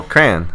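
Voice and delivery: "crayon" squeezed into one syllable, "cran". Shown as a demonstration. Insisting to co-host that crayon is one syllable. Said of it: "it's one syllable".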